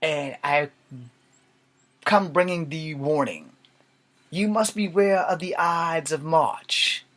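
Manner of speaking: slow theatrical proclamation; old beggar. Acted. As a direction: deliver a portentous stage warning. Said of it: "the old beggar".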